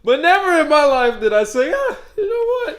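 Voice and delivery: high, loud, laughing disbelief; mock the choice through laughter; laughing in disbelief at a friend's wild story. Real, not acted.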